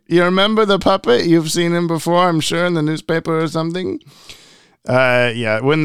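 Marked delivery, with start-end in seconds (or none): 0.1-4.0 high puppet voice, fading at the end; chirpy dummy who wants to be recognized; squeaky ventriloquist dummy. Retold or imitated. Voicing the puppet Scarface.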